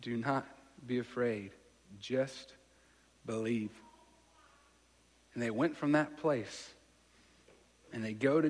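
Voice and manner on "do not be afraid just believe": soft, slow comfort; Jesus comforting the grieving father; calm reassurance. Retold or imitated. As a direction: reassure him gently and slowly. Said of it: "he said".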